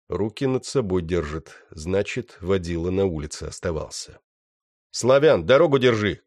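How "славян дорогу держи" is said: loud shout to partner. Acted. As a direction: yell the order across the yard. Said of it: "крикнул я". (громкий крик)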